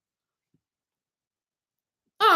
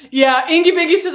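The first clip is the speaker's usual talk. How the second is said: usual speaking voice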